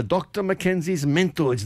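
thick Scottish brogue, ad voice; broad Scottish accent. Retold or imitated. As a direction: say it like an old Scottish radio ad. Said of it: "in a very, uh, thick Scottish brogue".

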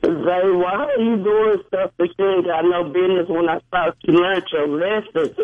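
usual speaking voice

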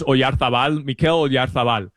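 Spanish pronunciation of the player's name; Spanish accent. Real, not acted. Pundit naming Spain's player for listeners, Spanish accent. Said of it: "I'm saying it with a Spanish accent".

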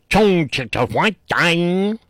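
cartoonish fake-Chinese gibberish, drawn out; mock Chinese. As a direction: imitate a cartoon Chinese accent. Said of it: "I know that was racist".

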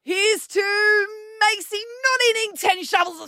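mock pub toast in a toddler's voice. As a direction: raise a glass like a tipsy toddler. Acting toddlers' cheers at table.

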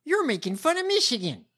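high, mock-indignant whine of a candidate; high-pitched send-up of her complaint; mocking the politician. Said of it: "Like, she's like".

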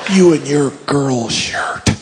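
usual speaking voice

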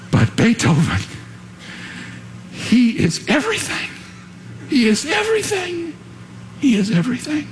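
acted awe, leaping high; overcome with emotion. Conductor telling his musicians of Beethoven, acted as overcome with emotion. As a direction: imitate an overwhelmed conductor, voice soaring on "everything". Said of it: "He was so overcome with emotion".

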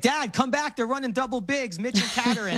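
high-pitched imitation of an eager kid; excited young son. Seven-year-old son calls dad back to the game, imitated excited kid. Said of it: "this is the kind of kid who".

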